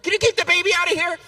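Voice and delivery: put-on rushed, exasperated call to get the baby out; stressed plea. Stressed husband snapping at his wife over the baby. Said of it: "I'm all stressed out now".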